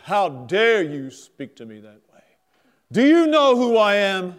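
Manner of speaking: loud, rising offended demand; indignant officer. Retold or imitated. Naval officer scolds the rude drunk sailors, indignant and loud. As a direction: puff up like an insulted officer.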